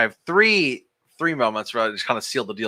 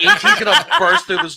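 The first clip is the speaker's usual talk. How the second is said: laughing through it; 5 dB louder than usual. Retold or imitated. Retelling childhood fear, laughing.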